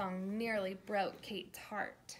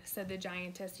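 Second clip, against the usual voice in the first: plain reading voice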